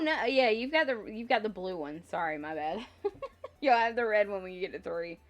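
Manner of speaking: usual speaking voice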